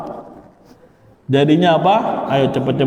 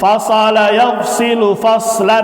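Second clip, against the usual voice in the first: recitation drill; 6 semitones higher, slower than usual. Shown as a demonstration. Teacher reciting the masdar gallery aloud, chanting. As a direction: recite each pattern clearly for the class.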